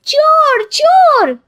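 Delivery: high, loud cry of thief; shouting thief. Acted. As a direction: raise the alarm at full voice. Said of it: "शोर मचाती है".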